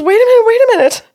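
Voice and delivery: high, fast, loud interruption; astonished realization. Retold or imitated. Client stunned by the medium's message, fast and loud. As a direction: burst out in rapid disbelief.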